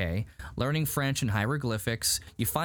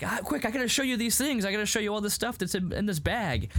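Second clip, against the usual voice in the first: higher, excited mock urgency; urgent, eager retelling; 9 semitones higher than usual. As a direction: imitate someone hurriedly eager to show something. Acting out his hurried pleading.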